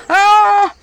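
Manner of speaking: high loud scream; terrified scream. Acted. Witch screams along with the mother, high loud scream. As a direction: scream back in fright. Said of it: "тоже страшно закричала".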